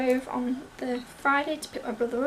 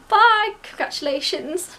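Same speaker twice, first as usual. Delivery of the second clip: louder, higher, drawn-out farewell; congratulatory goodbye; 6 semitones higher, 8 dB louder, slower than usual. Retold or imitated. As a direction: imitate an examiner's cheery send-off. Examiner's cheery goodbye retold.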